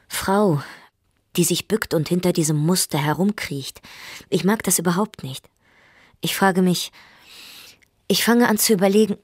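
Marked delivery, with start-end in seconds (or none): none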